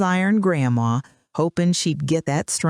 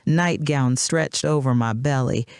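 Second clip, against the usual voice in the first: ordinary delivery, nothing marked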